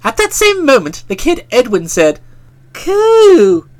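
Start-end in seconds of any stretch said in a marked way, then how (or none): 2.7-3.7 surprised coo. A narrator's reading. Boy's surprised exclamation.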